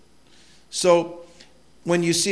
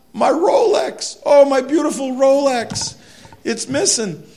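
mock sobbing; 12 semitones higher, 10 dB louder than usual. Preacher imitating the lawyer mourning his watch, hammed-up wail. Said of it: "the man is just sobbing".

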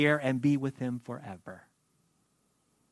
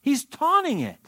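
high-pitched emphatic declaration; 8 semitones higher than usual. Real, not acted. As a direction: drive the point home with a high swoop on "taunting". Preacher drives home his point.

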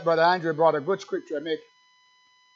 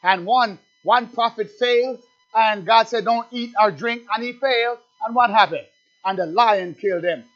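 raised, higher preaching voice, driving hard; fiery preaching; 4 semitones higher, 6 dB louder than usual. Acted. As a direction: preach it out to the congregation at full force. Preacher at full pitch.

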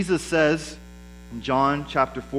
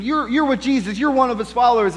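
mock accusation; 8 semitones higher, 5 dB louder than usual. Voicing an accuser confronting Peter.

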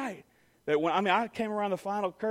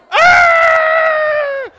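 high, very loud long scream; a kid shooting round the bend; scream down the slide; 19 dB louder than usual. Retold or imitated. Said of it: "he's going".

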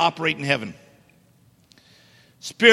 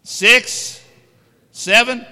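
rising auction calls, louder each; auctioneer calling bids; 5 semitones higher, 7 dB louder, much slower than usual. Pretend. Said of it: "Sound like an auctioneer".

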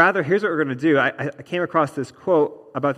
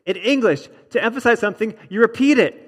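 "repeat" hit high and loud, then falling; deliberate, raised restatement, stressing "emphasize" and "repeat"; emphatic repetition; 5 semitones higher, 3 dB louder than usual. Shown as a demonstration. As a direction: build the echo to a punched "you repeat it". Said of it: "See what I did there?".

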